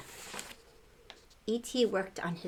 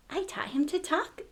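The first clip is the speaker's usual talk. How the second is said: bragging; 8 semitones higher than usual. Acted. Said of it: "Gertie bragged".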